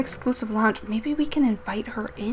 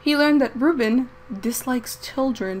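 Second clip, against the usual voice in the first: ordinary delivery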